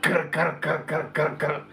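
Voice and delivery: string of harsh rolled rattle noises; imitated rattle. Passenger stepping out of the car, imitated rattle. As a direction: mimic the car rattling from outside. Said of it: "you get out the car".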